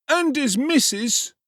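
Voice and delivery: high, loud, slow whine; whining boy cutting in. Acted. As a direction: chime in to pile on. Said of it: "interposed Mister Claypole".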